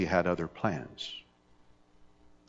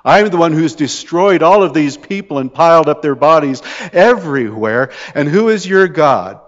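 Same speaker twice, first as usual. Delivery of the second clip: gloating king; 5 semitones higher, 8 dB louder than usual. Retold or imitated. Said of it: "gloating and goading and saying".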